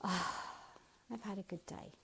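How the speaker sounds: soft sighed-out words; demonstrating a contented end-of-day sigh. Shown as a demonstration. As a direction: breathe out an "ah", then settle very softly. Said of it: "bring yourself into the present moment with a".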